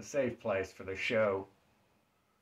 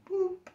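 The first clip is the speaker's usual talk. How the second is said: very high cute boop; magic wand boop. Pretend. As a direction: tap it out like a toy wand. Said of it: "That fairy wand can be powerful".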